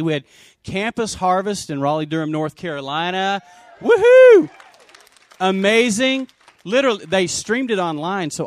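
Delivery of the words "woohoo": loud, much higher whoop of joy; cheering whoop. Real, not acted. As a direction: let out a big celebratory whoop. Cheering for the conference.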